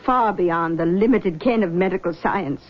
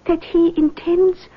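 lightly voiced mystic, higher pitch; seance medium; 7 semitones higher than usual. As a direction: greet them like a gracious clairvoyant. Medium reads the spirit.

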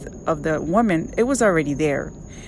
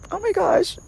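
moved exclamation; 14 semitones higher than usual. Retold or imitated. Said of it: "And I'm like".

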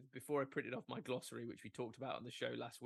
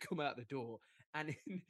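ordinary talk

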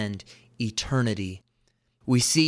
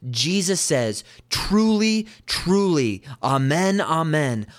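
much higher and louder "Truly, truly", settling on "amen, amen"; emphatic proclamation; 4 semitones higher, 4 dB louder, slower than usual. Shown as a demonstration. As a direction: proclaim it like a ringing declaration. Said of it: "dial in here even more".

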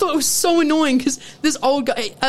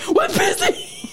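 very high-pitched imitated yell through laughter; mock screaming; slower than usual. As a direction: screech it comically, half laughing. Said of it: "We're just screaming at each other".

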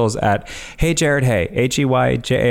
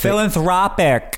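put-on Philanthropic in a thick, high, slow Long Island accent; 8 semitones higher than usual. Showing off a Long Island accent to the podcast hosts. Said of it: "In a Long Island accent for you".

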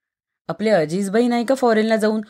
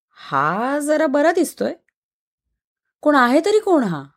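higher, slow lightly voiced girlfriend; friend's voice; 7 semitones higher than usual. A narrator's reading. Narrator voicing the friend looking at a photo. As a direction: read the friend's line a bit higher and slower.

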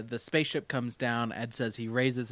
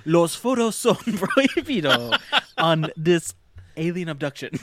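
high, loud mock announcer voice; Spanish ride announcer; 5 semitones higher, 7 dB louder than usual. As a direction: belt it out like a theme-park announcer, laughing. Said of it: "And then you have".

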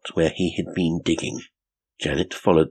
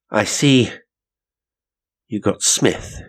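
ordinary delivery

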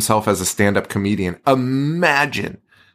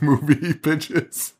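normal talking voice

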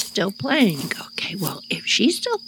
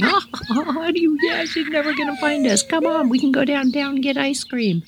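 cackling friend; 8 semitones higher than usual. Retold or imitated. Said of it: "She just started cackling".

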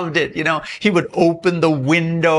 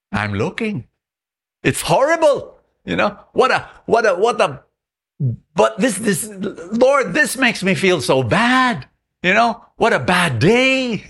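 higher, pained lament to God; distressed complaint; 7 semitones higher than usual. Acted. Friend complaining to God about his dented car, pained and higher. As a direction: whine out the misery over the dent.

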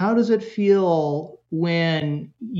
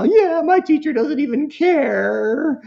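much higher, slower, whining son; whiny kid voice; 10 semitones higher, 4 dB louder, slower than usual. Retold or imitated. Son griping to mom about his teacher, high whiny voice. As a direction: pitch way up like a sulky teen.

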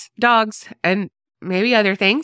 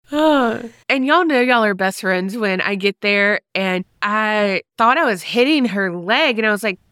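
ordinary delivery

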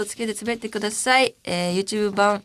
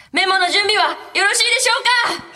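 high, loud mock announcer yell; ふざけた絶叫告知; 12 semitones higher, 6 dB louder than usual. Radio host hamming up the memo question to listeners, high mock shouting. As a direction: belt out the routine question as a silly shout. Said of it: "こういうこと？ こういうふうに？".

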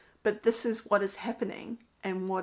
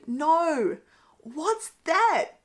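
put-on frustrated child; 7 semitones higher, 5 dB louder, much slower than usual. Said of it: "full of frustration".

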